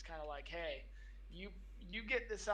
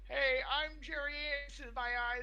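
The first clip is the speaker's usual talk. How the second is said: higher, louder bumbling TV weatherman; goofy weatherman voice; 8 semitones higher, 4 dB louder than usual. Acted. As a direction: present the weather like a hapless TV host. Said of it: "you'll appreciate this one".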